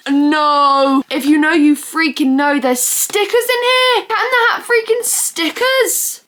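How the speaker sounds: loud, rising to a high-pitched squeal; overexcited squealing. Real, not acted. Fan finding Cat in the Hat stickers, excited squeal. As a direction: build to squealing delight over the find. Finds stickers inside favourite DVD.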